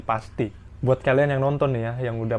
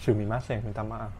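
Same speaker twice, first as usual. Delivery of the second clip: soft, small sumimasen; meek apology; 3 semitones lower, 8 dB quieter than usual. Retold or imitated. Junior apologizing to the senior, soft and meek. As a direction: apologize meekly under breath. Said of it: "Kata andalan apa".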